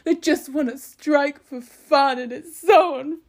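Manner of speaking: high, loud, exaggerated whiny imitation; mock whinging. Commentator mimicking the transport secretary griping about trade unions, mock whinging. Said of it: "whinging about trade unions".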